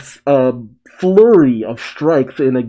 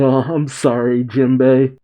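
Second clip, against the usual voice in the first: ordinary talk, nothing marked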